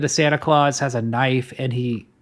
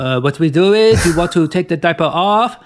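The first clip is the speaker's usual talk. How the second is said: put-on French accent; 3 semitones higher, 3 dB louder than usual. Mock French parenting advice.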